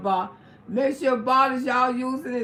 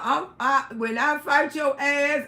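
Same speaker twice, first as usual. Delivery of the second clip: ordinary delivery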